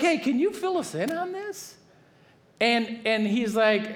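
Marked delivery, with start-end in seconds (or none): 0.0-1.8 high, eager questioning voice; a much higher eager ask, playing the curious leaders. Leaders ask Paul about the sect, eager and high.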